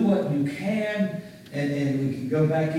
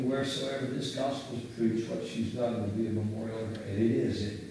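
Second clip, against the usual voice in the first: ordinary talk, nothing marked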